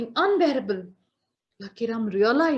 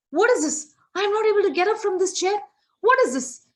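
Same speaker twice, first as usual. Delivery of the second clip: high, loud startled outburst; shocked and stuck; 9 semitones higher, 5 dB louder, faster than usual. Acted. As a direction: react as if glued to the chair. Yama finds himself stuck.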